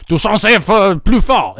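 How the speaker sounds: loud, scolding mock complainer; loud complaint. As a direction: complain forcefully as an annoyed listener. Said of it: "If people say".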